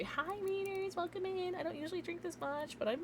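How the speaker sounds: tiny, high, very soft voice; sheepish greeting. Real, not acted. Streamer apologising to raiders for being rude, sheepish small voice.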